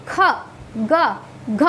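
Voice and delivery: ख, ग, घ one at a time from the throat; throat sounds. Shown as a demonstration. Teacher has learners touch the throat, saying ख, ग, घ from there. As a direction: drill ख, ग, घ separately, each made at the throat. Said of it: "यहाँ कंठ से आवाज़".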